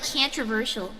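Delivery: southern twang turning "controversial" into "cantroversial"; southern accent. Shown as a demonstration. Streamer shows viewers a slip from doing southern voice, southern accent. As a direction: say "controversial" southern, first vowel as in "can't". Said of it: "doing my southern voice is messing up my".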